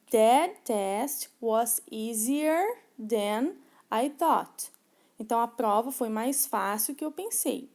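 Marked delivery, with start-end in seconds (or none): 0.1-4.7 very slow, deliberate English for learners; slow model sentence. Shown as a demonstration. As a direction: lay each word out like a teacher modeling. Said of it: "no exemplo tem aí". (frase em inglês bem lenta e didática)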